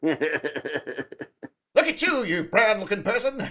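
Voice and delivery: gadget cop voice. Pretend. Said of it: "Like a racist Inspector Gadget".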